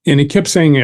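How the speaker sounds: ordinary talk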